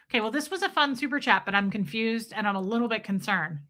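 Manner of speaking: ordinary talk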